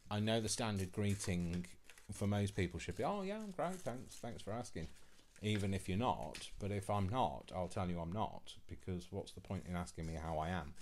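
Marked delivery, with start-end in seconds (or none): 3.0-4.9 high-pitched sing-out "oh", then softer thanks; mock polite reply. Imitating most people answering how are you, mock polite. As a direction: put on an automatic, too-pleasant small-talk answer.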